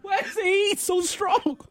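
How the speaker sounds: imitating quote. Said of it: "That quote".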